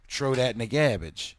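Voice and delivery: put-on "garbage" with a short flat a, no r; garbled garbage. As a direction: say "garbage" as GAB-idge, dropping the r. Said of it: "So I started saying".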